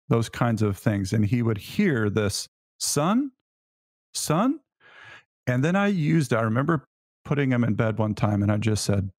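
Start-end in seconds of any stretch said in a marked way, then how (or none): 2.8-4.6 much higher, drawn-out exasperated call; impatient calling. Retold or imitated. Dad calling his son impatiently, much higher. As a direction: call him like he's tried you again. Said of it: "I always used it with this tone".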